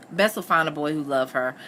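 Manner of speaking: ordinary delivery, nothing marked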